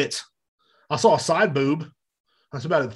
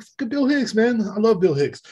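high, delighted fan praise; mock fanboy gush; 8 semitones higher than usual. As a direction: gush about your hero. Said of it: "I'm like".

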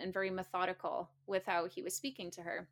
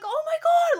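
much higher and louder, scandalised "Oh my God"; shocked gasp; 14 dB louder than usual. Retold or imitated. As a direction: blurt it out as if stunned in front of everyone. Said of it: "in shock".

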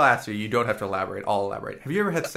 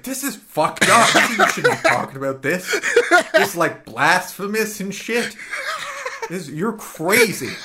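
laughing inner outrage; 7 semitones higher, 4 dB louder than usual. Retold or imitated. Inner thought retold, laughing.

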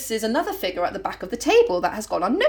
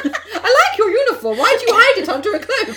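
shrill mock interrogation; 10 semitones higher, 5 dB louder than usual. Mock grilling the ugly figure.

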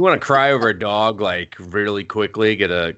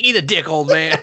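mocking retort; 7 semitones higher, 4 dB louder than usual. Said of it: "He's like".

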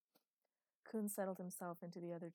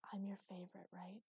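whisper. Acted. Father coaxing daughter confidentially.

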